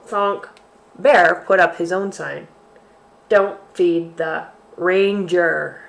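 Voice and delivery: slow, expressive picture-book reading; reading to children. Acted. Teacher reads a bear story aloud to kids. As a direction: read it out to little ones with relish.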